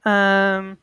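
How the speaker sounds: ordinary talk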